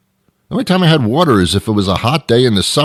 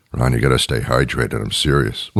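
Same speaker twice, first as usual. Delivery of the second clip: low, soft doctor voice; mock doctor; 10 semitones lower, 5 dB quieter than usual. Doctor nagging Ron to stay hydrated, low and soft. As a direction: imitate a doctor lecturing quietly. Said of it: "My doctor told me".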